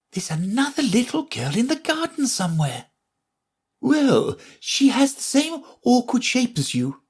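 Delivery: high, fussy flower voice; lofty little lady flower, talking down; prim Rose. Acted.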